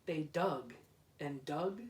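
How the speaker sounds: usual speaking voice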